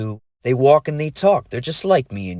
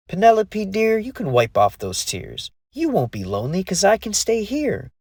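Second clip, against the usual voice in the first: reassuring fairy; 7 semitones higher than usual. A narrator's reading. Lee reassures crying dragon.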